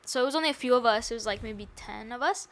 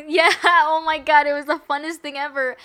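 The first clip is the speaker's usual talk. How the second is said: loud, high gush of excitement; excited; 5 semitones higher, 10 dB louder than usual. Real, not acted. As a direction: burst out in delight. Excited recalling party game.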